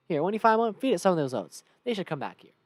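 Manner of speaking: loud Western merchant; horse seller. Acted. Horse seller hands the player oats, loud Western voice. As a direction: instruct heartily in character.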